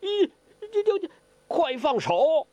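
high, stammering yelp of pain; stuttering pained cry. Acted. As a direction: cry out as your wrist is crushed. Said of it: "忙叫".

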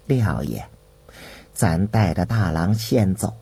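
very low, grave character voice; low and measured, discreetly entreating; eunuch giving instructions. A narrator's reading. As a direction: confide the request in a deep, careful register.

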